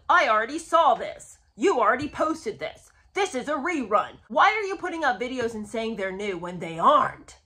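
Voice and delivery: loud, hammed-up complaining; mock outraged commenters. Host imitates viewers complaining about reposted clips, loud mock outrage. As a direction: imitate indignant viewers piling on accusations, loudly. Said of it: "comments of people being like".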